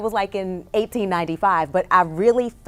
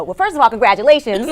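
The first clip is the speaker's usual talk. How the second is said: ordinary talk, nothing marked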